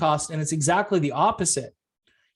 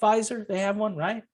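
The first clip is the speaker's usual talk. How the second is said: mock-innocent wonder; 6 semitones higher than usual. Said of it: "I'll sit there going".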